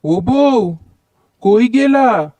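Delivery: high-pitched calling out across the house to his wife; husband yelling for his wife to come quickly; bellowing for wife. Acted. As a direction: holler for her from another room. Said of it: "এরকম ষাঁড়ের মতো চিৎকার করছো কেন?".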